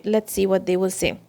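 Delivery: ordinary talk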